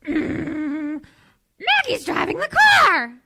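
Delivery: high, slow cartoon mom voice; frazzled cartoon housewife, drawn out; Marge Simpson voice. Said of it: "I can do Marge Simpson".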